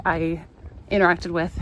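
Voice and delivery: normal talking voice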